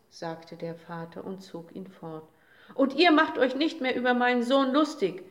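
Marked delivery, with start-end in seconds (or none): 2.7-5.2 loud, sharp rebuke; venomous shout. A narrator's reading. Father rebukes the mocking days, a loud venomous shout. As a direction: snap it at the crowd, defending the son. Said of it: "rief er giftig".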